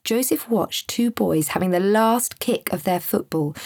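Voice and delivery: usual speaking voice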